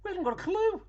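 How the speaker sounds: high, loud mock disbelief; retold bafflement. Couple left staring after the stranger, baffled.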